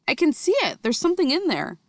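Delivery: put-on girl crying out in amazement; excited cry. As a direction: Sophie thrilled to spot it. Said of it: "cried out".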